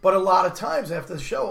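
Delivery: usual speaking voice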